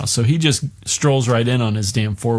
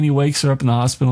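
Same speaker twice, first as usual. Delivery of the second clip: ordinary delivery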